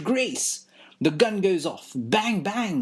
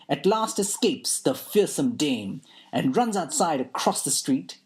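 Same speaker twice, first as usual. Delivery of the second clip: plain reading voice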